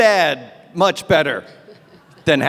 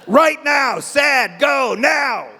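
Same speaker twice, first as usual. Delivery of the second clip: mock drill-sergeant order; 5 semitones higher, much slower than usual. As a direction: bark it like a coach ordering sadness. Calendar barking orders.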